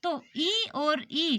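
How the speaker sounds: ई and ई drawn out very slowly; slow careful vowel drill; vowel demo. Shown as a demonstration. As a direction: stretch each ई long. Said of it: "तालु यानी".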